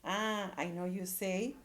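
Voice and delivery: ordinary talk, nothing marked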